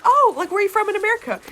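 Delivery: high, quick, eager ask; imitated keen question. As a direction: imitate a friend's overly interested question. Retelling the friend's question to him.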